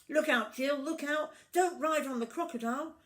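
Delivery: high, loud warning cry; urgent bird warning; alarmed warning. A narrator's reading. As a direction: bird calling down to Jill.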